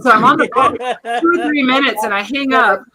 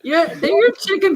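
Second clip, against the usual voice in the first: much higher squeaky voice; high pitch; 11 semitones higher than usual. Acted. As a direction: pitch it way up like the toy. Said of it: "the high pitch".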